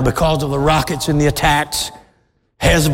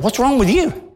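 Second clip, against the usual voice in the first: mock dismissive; 6 semitones higher than usual. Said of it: "they looked at him and said".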